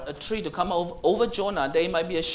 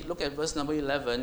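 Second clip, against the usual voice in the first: ordinary talk, nothing marked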